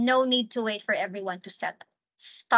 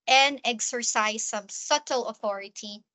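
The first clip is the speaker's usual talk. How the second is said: ordinary delivery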